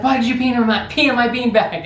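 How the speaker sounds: put-on older brother confronting little brother over the beanbag, outraged; mock outraged brother. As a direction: demand an answer, outraged. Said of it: "he's like".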